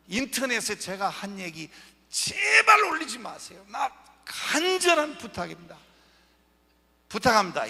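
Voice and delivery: loud, high, urgent plea; earnest pleading shout. Real, not acted. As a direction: beg the room to keep this offline. Pleading audience not to post online.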